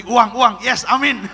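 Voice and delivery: loud, excited cry for money; mock eager shout. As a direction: shout it like a sleeper waking for cash. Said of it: "Yang mau tidur langsung bangun". (teriakan girang pura-pura)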